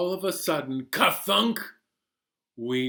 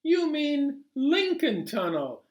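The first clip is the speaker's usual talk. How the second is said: puzzled narrator; 11 semitones higher than usual. Acted. Narrator voices himself, puzzled.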